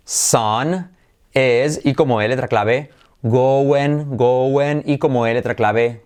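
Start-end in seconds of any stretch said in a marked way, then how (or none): none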